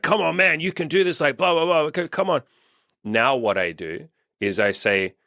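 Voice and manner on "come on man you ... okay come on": high, hyped-up pep talk. Retold or imitated. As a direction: pump him up like an old-school motivator. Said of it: "motivation and be like".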